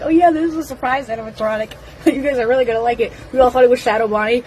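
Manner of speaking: rattled off very fast; rapid retold quote. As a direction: rush through someone else's chatty remark. Retelling the actress's words, rapidly.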